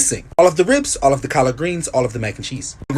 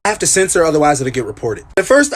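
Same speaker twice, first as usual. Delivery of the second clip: ordinary talk, nothing marked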